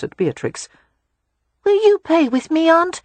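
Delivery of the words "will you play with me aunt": put-on high, eager child's voice; a little boy begging his aunt to play; high hopeful child voice; eager small boy. A narrator's reading. Rye asks aunt to play.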